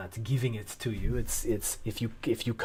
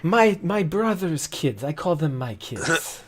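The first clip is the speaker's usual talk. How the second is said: a higher, pleading merchant voice; a merchant held by his collar, pleading; flustered merchant; 8 semitones higher than usual. Acted. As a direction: beg your way out of trouble. Sensu keeps bargaining.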